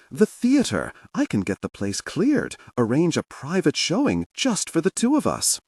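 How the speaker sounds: high, keen offer; eager pitch. Acted. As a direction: pitch the idea like a boy. Orso eagerly proposing private show.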